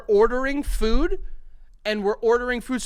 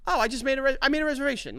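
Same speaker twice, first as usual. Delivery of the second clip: higher-pitched mock self-justifying quote; lightly acted, pitched up, over-explaining; sheepish announcement; 7 semitones higher, faster than usual. Diner telling host at empty restaurant, sheepish announcement.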